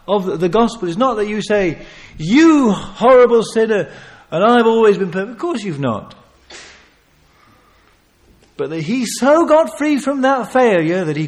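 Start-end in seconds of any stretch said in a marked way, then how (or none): 2.2-5.3 loud, haughty condemnation; self-righteous scolding. Acted. A smug preacher scolding a sinner, loud. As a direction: sneer down at the sinner.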